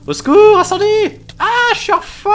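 hammed-up terrified screaming; mock screams for help. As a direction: shriek for help like you're on fire. Burning, calling for help.

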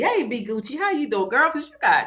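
ordinary talk

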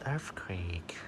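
hushed whisper; sudden whispered aside. Real, not acted. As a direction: drop to a whisper. Pet owner whispers during cat play.

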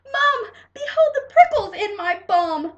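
high, full-voiced comic wail to Mom; loud shout. A narrator's reading. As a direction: cry out for Mom in mock agony. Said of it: "I shouted".

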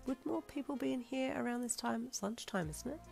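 wondering. A narrator's reading. Follower noticing the empty shop at lunchtime, softly wondering. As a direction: wonder it quietly, almost to yourself. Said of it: "you wondered".

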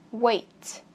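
"Weight" drawn slightly, T puffed out; teacher's careful "Weight"; final T released. Shown as a demonstration. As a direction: let "Weight" end on a crisp T. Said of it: "Bật chữ T này ra".

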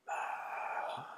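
faint voiceless whisper from deep below; ghostly whisper. Acted. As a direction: breathe it like a lost voice. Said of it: "a lost voice whispered".

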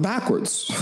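enjoy the joke on yourself; laughing. Real, not acted. Recalling the backwards wetsuit, laughing. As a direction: laugh right after the punchline. Wardrobe punchline.

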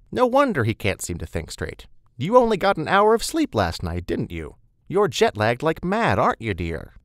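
high, loud, lively sympathy with dropped tag questions; motherly, fussing Beth. Acted. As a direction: fuss over the newcomer like a mother.

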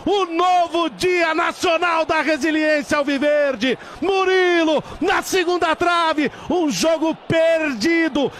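shouted, triumphant football call; goal celebration commentary. Acted.

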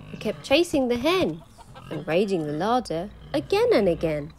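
ordinary delivery

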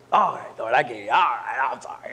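low, mumbled giving-in; acted grudging surrender. Husband apologising to the Lord, low and grudging. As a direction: concede with voice sunk low.

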